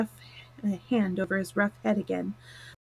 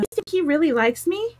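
higher voice, wanting to know; eager cry; 7 semitones higher, faster than usual. A narrator's reading. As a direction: ask eagerly with a rising lilt. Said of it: "cried Mary eagerly. She did so want to know".

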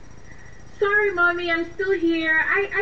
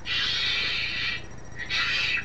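shushing; 5 dB quieter than usual. Acted. Hushing her boyfriend so the uncle outside won't hear. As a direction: hiss a quick hush.

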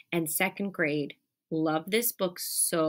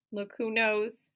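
ordinary delivery

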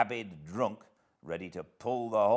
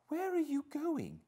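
very high-pitched demanding question; high, insistent challenge without extra volume. A narrator's reading. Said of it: "demanded Catherine".

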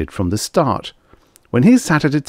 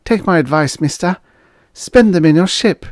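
put-on high-pitched, insistent girl; emphatic; 11 semitones higher, 4 dB louder than usual. A narrator's reading. Waitress warns trucker off the hotel, emphatic. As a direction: press the warning home, louder at the end. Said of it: "The girl was emphatic".

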